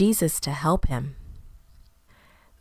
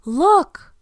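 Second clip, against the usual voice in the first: high, loud excited shout; 10 semitones higher, 7 dB louder than usual. Acted. Someone in the crowd shouting to the others, high and loud. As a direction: call out to point at the cave. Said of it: "someone shouted".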